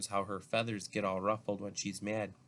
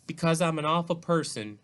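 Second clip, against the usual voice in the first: higher, louder, upset confession; tearful outburst; 7 semitones higher, 6 dB louder than usual. A narrator's reading. Olivia confessing to Justin why she is crying, tearful outburst. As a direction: burst out with it, upset. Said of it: "she finally says through her tears".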